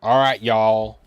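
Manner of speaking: loud put-on American drawl; hammed-up American "y'all"; American accent. Shown as a demonstration. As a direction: lay on a folksy American accent. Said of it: "I'll do the old American accent".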